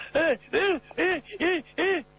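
high yelps of a man being shaken; shaken cries. A narrator's reading. Xia Xi yelping while He Long shakes him. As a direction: yelp high with each shove.

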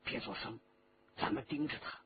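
hushed, soft and low-pitched. A narrator's reading. Xu urging the girl to watch the thief, low voice. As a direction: keep it down so nobody hears. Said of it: "低声地说".

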